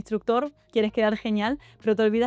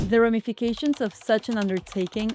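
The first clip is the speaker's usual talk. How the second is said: overly formal. Shown as a demonstration. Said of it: "le suelto lo siguiente".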